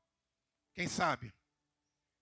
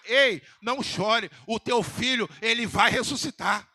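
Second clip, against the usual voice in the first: louder, slower, ringing promise; proclaimed shout; 4 dB louder than usual. Shown as a demonstration. Preacher repeats Jesus' promise to the widow, now shouted out loud. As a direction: call it out like a victory. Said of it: "Eu vou repetir de novo aqui".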